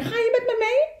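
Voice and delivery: very high small-animal question; little beaver voice. Acted. Little beaver inviting the duck into the boat, high voice.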